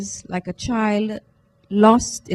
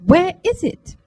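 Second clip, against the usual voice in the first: boasting; 6 semitones higher, 4 dB louder than usual. Acted. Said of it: "Fiona boasted".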